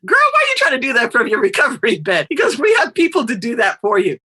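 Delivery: mock-scolding mayor voice; incredulous friend. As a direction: tease her like an exasperated friend. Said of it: "And he goes".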